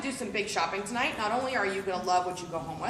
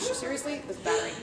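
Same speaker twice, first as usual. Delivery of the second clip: usual speaking voice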